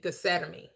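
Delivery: "Gethsemane" with a plain "get" start and short "mee" ending; church pronunciation. Shown as a demonstration. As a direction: say "Gethsemane" as geh-SET-uh-mee. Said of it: "they always pronounced it".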